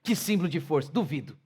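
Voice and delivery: low, soft ironic praise; sarcastic father. Retold or imitated. Father answers the quitting son with soft sarcasm. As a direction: say it as a doubting father would. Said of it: "Aí você fala o quê pra ele".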